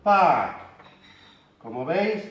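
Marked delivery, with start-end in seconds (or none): 0.0-0.5 park with a held long a, no r; long vowel park. Shown as a demonstration. Teacher modelling park's long vowel. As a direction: stretch the a in park. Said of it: "la representación fonética sería".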